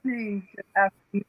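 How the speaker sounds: breaking up, cutting in and out; like a bad video call connection; glitchy audio. Real, not acted. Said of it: "your audio is cutting out for me a bit".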